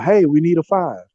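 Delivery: yelling out. Retold or imitated. Volunteer calling out for a bag by size, mild retold yell. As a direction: imitate calling an order across the room, lightly. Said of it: "we would just yell out".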